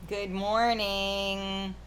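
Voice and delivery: stretched-out, sing-out good mornings; drawn-out greeting. Real, not acted. Host greeting viewers as the stream opens, drawn-out. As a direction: draw each morning out long and slow.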